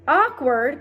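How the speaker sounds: high loud incredulous echo; cried. A narrator's reading. Earthworm scoffs at the grasshopper, incredulous cry. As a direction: throw the word back. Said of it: "cried the earthworm".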